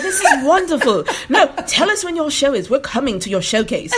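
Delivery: high, bright, laughing mock fan; gushing admirer. Imitating Europeans promising to attend her show, bright and high. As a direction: chirp it up like an eager fan. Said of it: "They're like".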